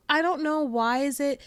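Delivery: ordinary talk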